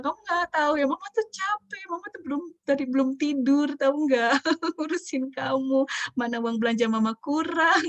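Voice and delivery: high-pitched mock whining tirade; playfully hammed-up grumbling; overflowing venting. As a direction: act a tired mum unloading every complaint on her child. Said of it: "kita sering kali curcol kebablasan".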